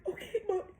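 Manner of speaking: put-on high-pitched crying little girl; tearful child. Daughter agrees to obey her mommy, crying. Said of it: "She's crying".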